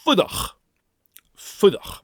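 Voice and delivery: "Fudach" with harsh broad ch; Gaelic fudach. Shown as a demonstration. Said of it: "This is the sound you will make".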